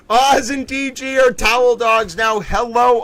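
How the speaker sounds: excited greeting. Real, not acted.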